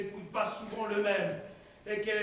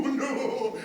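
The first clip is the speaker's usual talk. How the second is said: normal talking voice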